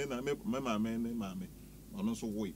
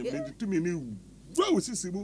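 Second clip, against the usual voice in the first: playful preacher-style blessing, a little louder; mock prayer; 7 semitones higher, 5 dB louder than usual. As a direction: pronounce a blessing like a pastor, half joking.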